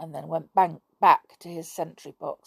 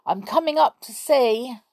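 calling up; 7 semitones higher, 5 dB louder than usual. Acted. Boy calling up tree.

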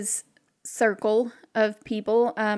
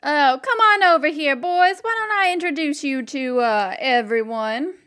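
hearty call; 4 semitones higher, 6 dB louder than usual. Acted. Sheriff greets the players loudly.

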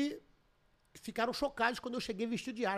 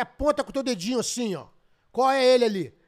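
raised, laughing command to point; loud retold order; 2 semitones higher, 9 dB louder than usual. Teller retells telling the guard to point, loud laughing order. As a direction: bark at the guard to point him out. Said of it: "Eu falei".

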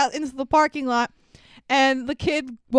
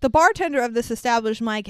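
ordinary delivery

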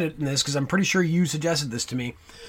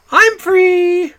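high, loud, camp sing-song I'm free; loud, high camp I'm free as Mister Humphreys; campy catchphrase; 13 semitones higher, 10 dB louder than usual. Retold or imitated.

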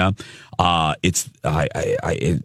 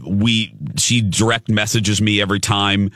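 ordinary talk

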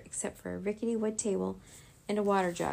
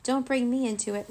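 raised, higher comeback; sharp retort; 4 semitones higher, 5 dB louder than usual. A narrator's reading. Rory rejects Echo's blame, shooting back. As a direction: fire it straight back, louder. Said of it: "Rory shot back".

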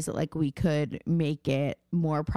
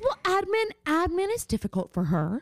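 mom voice; 11 semitones higher than usual. Retold or imitated. Daughter voices mom defending her.

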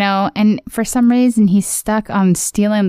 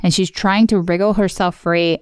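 ordinary talk

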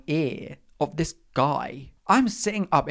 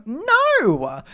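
high, drawn-out theatrical no; emphatic refusal; 10 semitones higher, 4 dB louder than usual. Acted. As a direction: refuse it as if the idea is absurd. Answers own rhetorical question.

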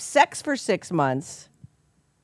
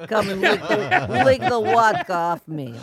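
put-on Russian accent; 2 semitones higher, 5 dB louder, slower than usual. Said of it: "That's not a bad accent".

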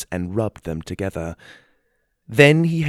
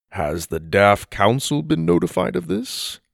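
higher, pointed question; probing knight; 6 semitones higher than usual. Acted. Theodore questions them.

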